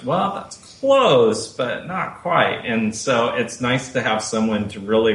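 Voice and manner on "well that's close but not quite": slow, higher imitation of the coach's verdict; Tatiana's correction. Actor imitating his Russian coach correcting his pronunciation. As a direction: drag it out like a gentle accent coach. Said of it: "Tatiana goes".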